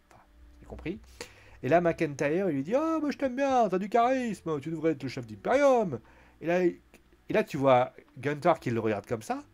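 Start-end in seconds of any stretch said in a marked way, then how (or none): 2.7-6.0 high, loud teasing retort; mock teasing. McIntyre flattering Kaiser over Imperium, mock teasing. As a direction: needle him in a raised, lively voice.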